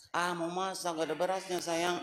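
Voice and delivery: very soft, low loving ask; tender request. Shown as a demonstration. Wife tenderly telling husband there's no rice, very soft. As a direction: murmur it sweetly to him. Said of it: "Jangan pakai nafsu".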